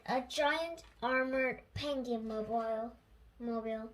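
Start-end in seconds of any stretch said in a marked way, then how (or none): none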